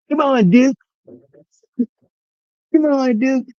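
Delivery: high-pitched call to a viewer in chat. Real, not acted. Calling viewer Duke again.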